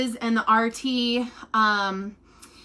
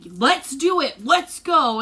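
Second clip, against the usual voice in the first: imitating a pumped-up nurse, louder and higher; all jazzed up; 3 semitones higher, 4 dB louder, slower than usual. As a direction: mimic a nurse raring to go. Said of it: "got the nurse this morning all jazzed up".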